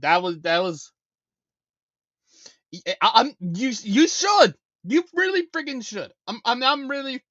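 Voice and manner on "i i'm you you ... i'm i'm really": higher, louder exasperated outburst; a frustrated streamer scolding a viewer; real anger. Real, not acted. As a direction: let the frustration burst out. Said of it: "I'm really freaking mad about that".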